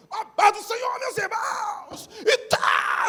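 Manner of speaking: hammered-up shouting preacher, high and loud; shouting pastor. Retold or imitated. Mocking a loud pastor greeting the church, shouting. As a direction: scream the greeting like a wild preacher. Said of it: "Vamos ouvir o que o pastor diz".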